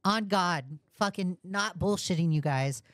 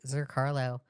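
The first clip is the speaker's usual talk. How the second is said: ordinary delivery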